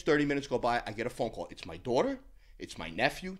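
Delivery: usual speaking voice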